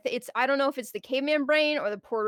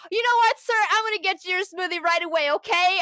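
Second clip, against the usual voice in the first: loud, high, seething customer-service voice; mock politeness bursting with irritation; forced-polite anger; 7 semitones higher, 5 dB louder than usual. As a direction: push the courtesy out loud and tight. Retold mad reply to rude customer.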